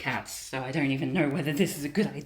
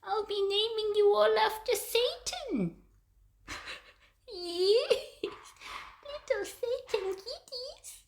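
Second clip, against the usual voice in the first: very high, gleeful cooing; slower than usual. Real, not acted. Cat sitter naming kittens after Satan, high baby talk. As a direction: coo sweetly in a tiny high voice.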